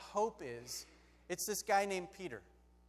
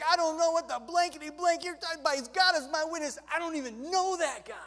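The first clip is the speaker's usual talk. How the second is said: loud, high, hammed-up denial; panicked Peter; 7 semitones higher, 8 dB louder, faster than usual. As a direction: blurt it out in mock panic. Peter frantically denies knowing Jesus.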